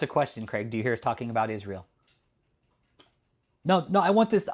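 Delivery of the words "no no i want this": annoyed outburst. Real, not acted. Annoyed at chatter Craig.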